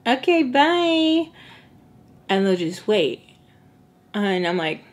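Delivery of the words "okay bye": high, drawn-out polite okay bye; mock sweet goodbye. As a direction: stretch it out like a fake-polite brush-off.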